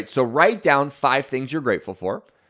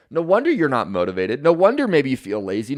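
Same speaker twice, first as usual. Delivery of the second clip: ordinary talk, nothing marked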